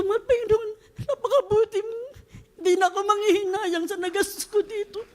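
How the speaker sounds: laughing through tears, voice high and cracking; overwhelmed thanks to the Lord, half laughing, half crying; tearful joyful prayer. Retold or imitated.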